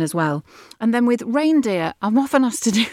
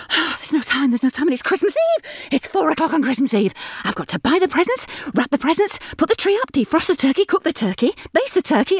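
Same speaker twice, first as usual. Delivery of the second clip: frantic, fast and much higher, rising panic; a mum racing the clock on Christmas Eve; harried mum in a festive advert; overwrought mum; 8 semitones higher, faster than usual. Acted. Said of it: "the overwrought mum".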